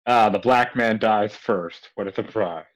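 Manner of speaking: usual speaking voice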